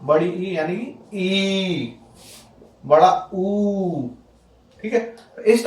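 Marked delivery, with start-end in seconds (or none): 0.3-0.5 ई drawn out, steady; long vowel. Shown as a demonstration. Teacher showing students the big ई, long vowel. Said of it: "जिनके उच्चारण में अधिक समय लगे". 3.3-4.1 ऊ drawn out, steady; long vowel. Shown as a demonstration. Teacher showing students the big ऊ, long vowel. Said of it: "जिनके उच्चारण में अधिक समय लगे".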